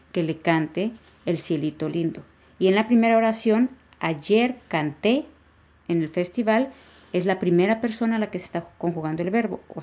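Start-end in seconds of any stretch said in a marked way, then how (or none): 4.0-5.2 "canté" drawn out on its last syllable; slow demo, "canté" end-stressed on é; stress on final E. Shown as a demonstration. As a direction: lean slowly on the -té of "canté". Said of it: "La primera sí lleva acento".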